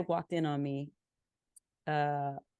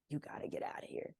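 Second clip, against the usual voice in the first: very soft, low retold aside; quiet, discreet warning, nearly whispered; hushed urging; 3 semitones lower, 7 dB quieter than usual. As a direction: urge them out under your breath. Retold urgent aside to the girl.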